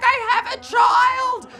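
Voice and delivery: very high, slow, loud wail; desperate plea. Acted. Late father pleading with gate attendant, desperate high wail. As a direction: shriek it out as a last resort.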